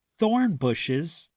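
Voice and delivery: usual speaking voice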